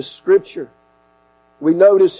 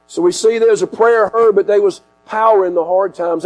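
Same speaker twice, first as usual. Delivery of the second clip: usual speaking voice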